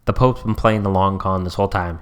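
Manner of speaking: ordinary talk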